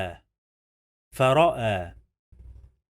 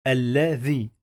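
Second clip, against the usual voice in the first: ordinary delivery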